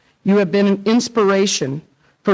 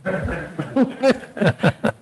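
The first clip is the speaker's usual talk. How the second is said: brief amused laugh at the teasing; soft chuckle before speaking; short laugh; 4 dB quieter than usual. Real, not acted. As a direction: laugh it off, a little embarrassed. Laughs after being teased about stage.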